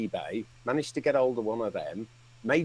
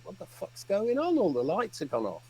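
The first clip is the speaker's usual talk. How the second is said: alarmed; 8 semitones higher than usual. Retold or imitated. Retold alarm at blackout.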